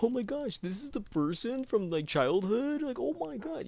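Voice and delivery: squealing high mock fan gush; fanboying. Cult member spotting childhood star, high excited gush. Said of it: "they're, like, fanboying".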